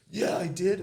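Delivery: loud, high, elated reply; excited agreement. Retold or imitated. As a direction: answer as the thrilled young guy, full of energy. Young man thrilled, answering the teller.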